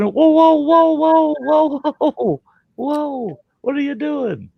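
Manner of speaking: very high, loud panicked whoas. Retold or imitated. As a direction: yelp it out as you get grabbed. Slammed against the wall.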